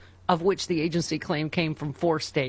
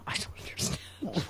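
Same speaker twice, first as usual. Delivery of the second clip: whispering; 9 dB quieter than usual. Real, not acted. Confused by quote.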